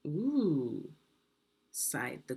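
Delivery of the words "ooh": contented sigh. Acted. Queen tasting the stew, contented sigh. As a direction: let out a pleased sigh.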